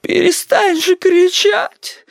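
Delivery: very high, drawn-out wail; imploring granny cry; pleading grandma. A narrator's reading. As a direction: beg in a slow, high old-woman voice. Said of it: "взмолилась бабуля".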